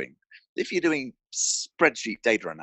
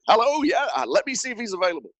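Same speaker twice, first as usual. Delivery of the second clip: much higher, fast and chirpy fake receptionist voice; mock receptionist; 14 semitones higher, 3 dB louder, faster than usual. Founder posing as his own receptionist, high and quick. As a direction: play a pretend front desk and stall the caller. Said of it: "I'm like".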